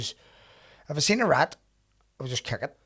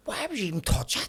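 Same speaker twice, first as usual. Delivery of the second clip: disgusted; 6 semitones higher than usual. Retold or imitated. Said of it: "I was like".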